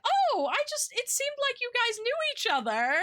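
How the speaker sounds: put-on high, sweet little-old-lady voice; sweet grandma voice. Grandma voiced in retold story.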